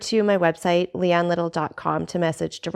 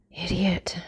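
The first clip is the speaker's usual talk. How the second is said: muttered; 6 dB quieter than usual. Acted. Danglars dismissing Fernand's fears, muttered softly. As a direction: mutter it under the breath, contemptuous. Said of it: "muttered Danglars".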